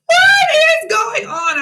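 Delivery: very high, drawn-out squeal; hammed-up squeal of bewilderment; shocked disbelief. Her shocked reaction to pregnancy claim.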